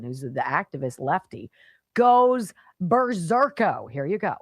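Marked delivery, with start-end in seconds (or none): 1.9-3.9 louder, higher, drawn-out goes berserko; 1 mock berserk. Host mocking an activist's meltdown, loud drawn-out jeer. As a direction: stretch it out like a freakout.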